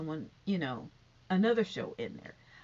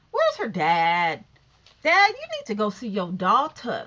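calling out; 9 dB louder than usual. Real, not acted. Vlogger calling on Wendy's father to visit, loud call. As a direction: call out to the absent father, louder.

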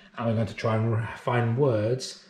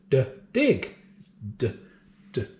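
usual speaking voice

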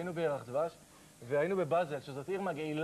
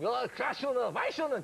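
put-on higher, gibberish German-accent chatter; mock German babble; 4 semitones higher than usual. Comic imitates German drinkers in mock German accent. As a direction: parody drunk Germans in a pub. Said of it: "mock German drinkers".

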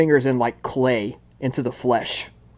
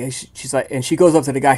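ordinary delivery

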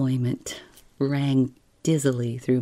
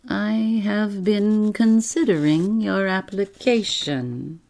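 clear, thin, higher voice; painful deliberation; 5 semitones higher than usual. A narrator's reading. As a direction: savor each syllable before delivering it. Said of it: "painful deliberation".

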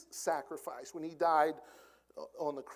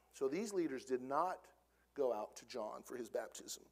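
normal talking voice

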